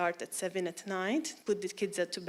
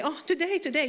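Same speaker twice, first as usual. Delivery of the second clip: high, insistent invitation; imitated excited hostess; eager church lady; 7 semitones higher, 3 dB louder, faster than usual. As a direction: insist eagerly with pitch raised.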